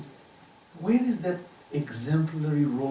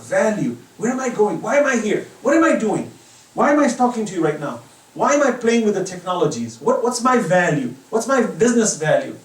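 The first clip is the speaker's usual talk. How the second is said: loud, high, impassioned preaching; shouted questions; 7 semitones higher, 10 dB louder than usual. Real, not acted. Preacher shouting life's big questions at congregation. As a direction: hammer each question at the crowd.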